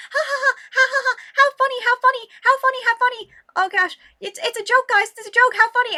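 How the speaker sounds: high, loud, strained mock laughter; manic fake laughing. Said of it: "randomly started screaming against my wall".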